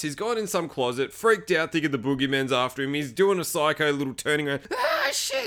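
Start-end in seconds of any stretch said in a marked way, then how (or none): 4.8-5.5 high-pitched startled yelp; hammed-up fright, voice shooting up; mock panic. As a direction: yelp it as if jumped. Acts out man freaking out.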